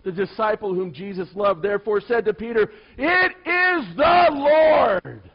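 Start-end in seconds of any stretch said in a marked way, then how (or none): 3.0-5.2 slow, drawn-out shout, much higher and louder; excited shout. Acted. Disciple calling to Peter from the boat, slow awed shout. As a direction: cry it out across the water in awe.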